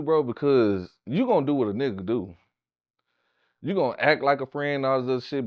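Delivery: ordinary delivery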